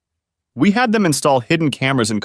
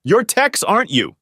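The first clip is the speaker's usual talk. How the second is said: higher, mock yelling judge; angry yell; 11 semitones higher than usual. A narrator's reading. Angry judge yelling at the inmate, higher-pitched mock shout. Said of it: "he was still yelling".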